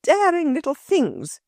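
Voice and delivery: high, indignant scolding; furious woman scolding intruding children; angry cry. A narrator's reading. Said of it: "she cried".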